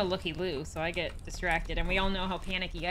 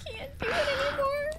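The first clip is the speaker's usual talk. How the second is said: laughing. Real, not acted. Laughing too hard to play.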